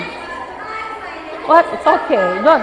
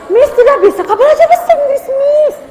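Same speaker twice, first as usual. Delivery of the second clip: higher, pleading pupil voice; mock whiny pupil; 7 semitones higher than usual. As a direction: whine like a reluctant student. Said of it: "Ada yang begitu?". (suara murid merengek)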